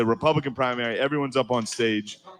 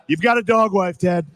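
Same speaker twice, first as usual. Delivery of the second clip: Trump impression; 7 semitones higher, 6 dB louder than usual. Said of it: "Trump voice quote".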